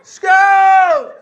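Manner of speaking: very high, loud mock scream; screaming Skittles. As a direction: howl the word like the ward patient. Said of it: "he would just scream".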